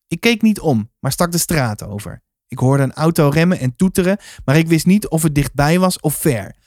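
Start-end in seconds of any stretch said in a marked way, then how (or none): none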